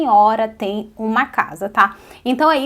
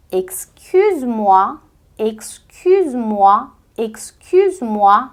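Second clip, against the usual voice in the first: excuse-moi drawn out slowly, rising; slow French drill; slower than usual. Shown as a demonstration. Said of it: "Repete comigo". (excuse-moi bem devagar)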